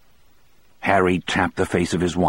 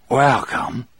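hoarse; 5 semitones higher than usual. A narrator's reading. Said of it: "he said in a hoarse voice".

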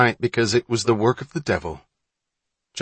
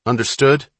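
louder, higher commanding check; deliberately gruff; 4 semitones higher, 5 dB louder than usual. A narrator's reading. Older brother reminding the younger who is boss, gruff. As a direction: demand agreement with put-on authority. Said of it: "Johnny's voice was deliberately gruff".

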